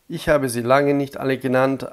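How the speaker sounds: ordinary talk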